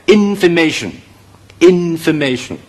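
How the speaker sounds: information開頭in加次重音; 讀information時開頭in要清楚有力; 開頭次重音. Shown as a demonstration. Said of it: "個次重音呢". (示範information，頭一音節帶次重音)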